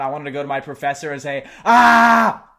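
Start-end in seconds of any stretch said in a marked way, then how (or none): 1.6-2.5 sudden high shouted ah; real loud scream. Retold or imitated. Student bursting out at the professor, loud high scream. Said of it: "I wanted to scream out".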